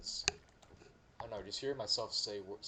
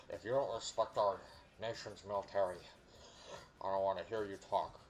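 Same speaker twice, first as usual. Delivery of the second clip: mocking pro-gun Southerner. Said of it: "my favorite to make fun of".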